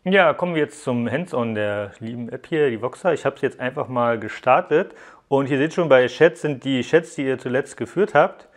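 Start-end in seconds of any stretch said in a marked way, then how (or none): none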